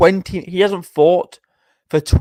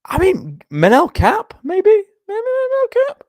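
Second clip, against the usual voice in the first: rising high questioning; 3 dB louder, slower than usual. Real, not acted. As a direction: voice climbs higher and higher. Musing matchup in rising voice.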